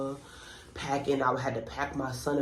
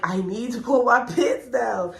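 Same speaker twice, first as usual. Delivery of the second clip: higher, emphatic re-enacted demand with a laugh; retold insistent repeat; 7 semitones higher, 4 dB louder than usual. Patient repeats herself to confused hospital staff, acted insistence. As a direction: insist on it, pitched up and amused.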